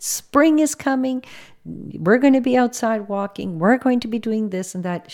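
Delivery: upbeat, encouraging lift in pitch; excited. Retold or imitated. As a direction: cheer her up with eager anticipation. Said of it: "just excited".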